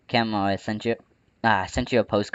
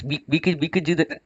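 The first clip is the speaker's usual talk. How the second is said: talking through hiccups; words broken by hiccups; 7 semitones higher than usual. Real, not acted. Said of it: "I keep getting hiccups".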